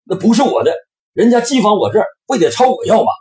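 angry. Acted. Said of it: "这下可火了".

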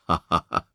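low, soft chuckle; a light, easy belly laugh. Acted. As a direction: laugh gently like the jolly Buddha. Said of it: "嘻嘻笑着说".

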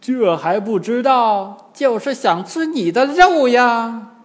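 high, loud, slow gloating; gloating demon old man. A narrator's reading. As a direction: draw out the threat with glee. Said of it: "老翁大笑道". (老翁得意地高声拖腔说)

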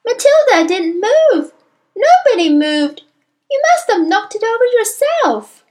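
put-on loud, very high children's protest; high-pitched clamour of pupils; crying out. A narrator's reading. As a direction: cry out in indignant defence. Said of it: "they cried out".

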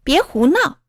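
acted, restrained shout at rowdy kids; shouted scolding. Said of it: "小胡喊".

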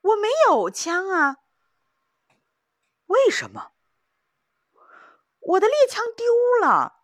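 high, loud, wailing; panicked cry. Acted. Young hunter wailing to the old hunter that his gun is lost, high and loud.

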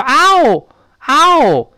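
mock yelp of pain, high and loud; drawn-out "ow!" as if stepping on a nail. Teacher playing someone who stepped on a nail, yelp of pain. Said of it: "突然间脚踩在钉子上了，一声叫".

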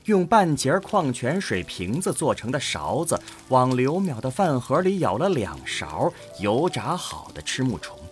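ordinary delivery

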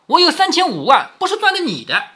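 high-pitched, blunt comeback; narrated wife's retort, pitched well up. A narrator's reading. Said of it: "这样不给面子".

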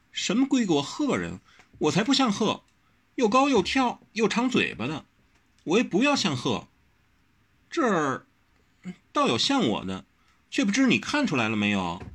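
put-on a headstrong girl brushing off flattery; Wen Rou's voice.

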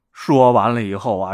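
ordinary delivery

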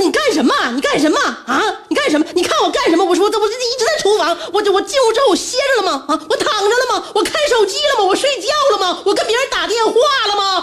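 high-pitched mock-hysterical scolding; retold angry outburst. Overwhelmed mother berating her son in the retelling, high-pitched outburst. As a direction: fire indignant questions, high and exasperated. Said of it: "我大声就控诉他".

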